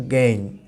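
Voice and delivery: "গেইন" for again, the first a almost gone; weak opening vowel. Shown as a demonstration. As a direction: say "গেইন" low, with only a trace of a before it. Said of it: "আটা খুবই উইক হয়ে যাচ্ছে". (again-এর আ প্রায় না শুনিয়ে "গেইন")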